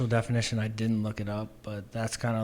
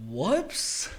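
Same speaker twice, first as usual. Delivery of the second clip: disbelieving echo; 8 semitones higher than usual. Retold or imitated. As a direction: repeat "Whoops?" high and rising in disbelief. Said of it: "and I was like".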